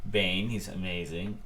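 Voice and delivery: usual speaking voice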